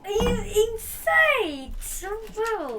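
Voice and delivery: high, slow shocked cry. Real, not acted. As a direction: gasp it out in disbelief. Boy shocked at number twelve.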